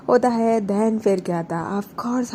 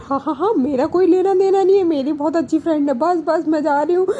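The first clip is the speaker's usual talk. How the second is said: laughing it off; 8 semitones higher, 7 dB louder than usual. Retold or imitated. Tanu embarrassed, laughing it off.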